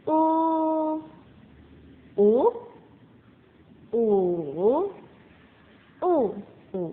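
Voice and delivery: "U" run through the tones in turn. Shown as a demonstration. Teacher modelling the tones on U. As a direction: say "U" in each tone. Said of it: "huruf vokal".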